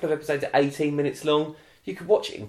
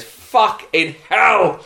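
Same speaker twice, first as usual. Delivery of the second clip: drawn-out, higher, louder exclamation of rage; outraged outburst; 5 semitones higher, 5 dB louder, much slower than usual. Real, not acted. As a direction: let the outrage burst out slowly and loudly. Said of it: "I have never shouted".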